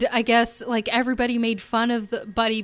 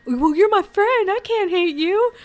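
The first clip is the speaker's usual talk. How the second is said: sincere Scott; 7 semitones higher than usual. Retold or imitated. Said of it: "Scott's like".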